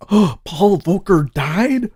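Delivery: stunned question at a headline; shocked surprise. Real, not acted. Streamer spots headline that Paul Volcker died, stunned surprise.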